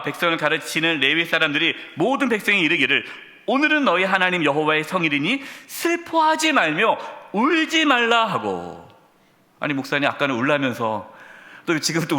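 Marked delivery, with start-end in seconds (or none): 3.5-8.2 scripture reading. Acted. Reads scripture aloud.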